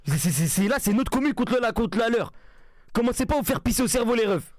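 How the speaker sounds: loud rant. Real, not acted. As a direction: bark warnings at the viewers. Engueule le chat en direct. (crie sur le chat, fort et énervé)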